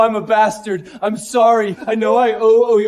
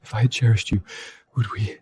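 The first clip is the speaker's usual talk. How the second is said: faint, broken words of a dying man; fading breath, barely able to speak; dying weakly; 10 semitones lower, 7 dB quieter, faster than usual. Acted. Stabbed, blood pouring from mouth.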